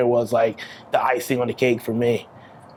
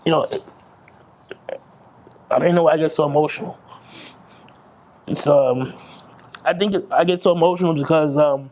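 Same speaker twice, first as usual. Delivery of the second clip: choked up. Real, not acted. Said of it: "it's emotional as hell".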